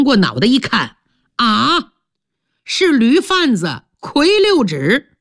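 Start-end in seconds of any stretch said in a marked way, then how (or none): none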